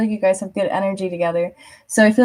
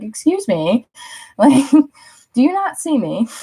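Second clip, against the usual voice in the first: mock indignant; 5 semitones higher than usual. Performer demanding attention on stage.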